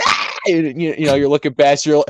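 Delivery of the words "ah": short, high acted yell; mock yell. Driver passing a slow granny in a story, mock yell. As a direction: let out a mock scream mid-story. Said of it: "You're like".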